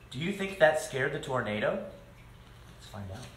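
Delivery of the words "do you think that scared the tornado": usual speaking voice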